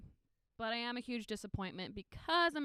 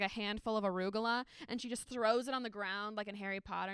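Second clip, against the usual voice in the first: usual speaking voice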